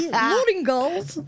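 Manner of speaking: ballet teacher voice. Retold or imitated. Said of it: "She came in".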